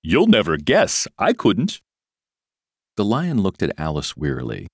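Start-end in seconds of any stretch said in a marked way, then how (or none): 0.0-1.8 loud, high, teasing excitement; eager cry. A narrator's reading. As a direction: dare them to guess, eagerly. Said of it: "the unicorn cried eagerly".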